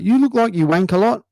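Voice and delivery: high-pitched mock New Zealand accent; yelled Kiwi heckle. Kiwi heckler interrupting the comedian, high yelled New Zealand accent. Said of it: "shrunken arm guys yelled out".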